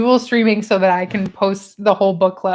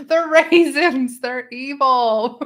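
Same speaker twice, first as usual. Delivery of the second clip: high, slow mock-sinister; hammed-up slow warning; mock ominous; 4 semitones higher, 5 dB louder, much slower than usual. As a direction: drag it out like a spooky tale.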